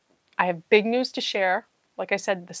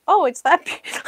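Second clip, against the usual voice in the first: mock chat voice; 7 semitones higher, 5 dB louder than usual. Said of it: "you all are gonna be like".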